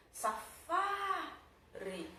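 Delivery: safari with FA held long and high, RI separate; stressed syllable drawn out. Shown as a demonstration. Teacher finding the tonic syllable, FA drawn out. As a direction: call out safari slowly, stretching a high FA. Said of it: "acabei de encontrar".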